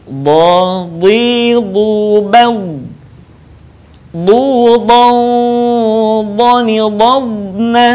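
"Dha, dhi, dhu, bal" chanted as a drill; drilled recitation. Shown as a demonstration. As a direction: recite each syllable clearly, like a Quran lesson.

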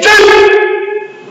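sharp, high drill shout; loud shout. Shown as a demonstration. Instructor cueing a downward block, loud shout. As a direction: shout the count high and sudden. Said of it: "And a big shout".

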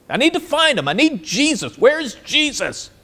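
high, pleading search; desperate plea. Acted. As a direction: cry out for him in desperation. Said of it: "Not even angels".

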